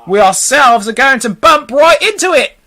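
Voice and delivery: high, emphatic warning; sharp warning. A narrator's reading. Centipede sees a collision coming, sharp warning. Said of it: "the centipede said sharply".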